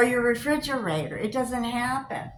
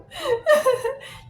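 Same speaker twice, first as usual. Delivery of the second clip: loud laugh; 8 dB louder than usual. Real, not acted. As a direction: burst of high laughter. Laughs at absurd story.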